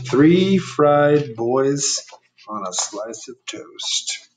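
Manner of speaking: put-on Three fried boys stretched very slowly; slow, emphatic reread of the giant's words; slow drawn-out giant line. Shown as a demonstration. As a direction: savour each word like a giant licking his lips. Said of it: "There's the old giant".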